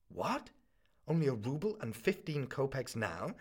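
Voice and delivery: shocked protest. Acted. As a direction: burst out in disbelief at the sum. Student shocked by the sum.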